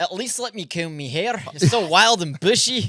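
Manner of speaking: slow, loud Scottish brogue on bushy; hammy Scottish voice; Scottish accent. Shown as a demonstration. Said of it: "Give me one. Scottish?".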